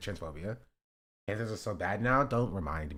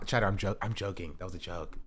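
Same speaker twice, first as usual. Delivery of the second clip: usual speaking voice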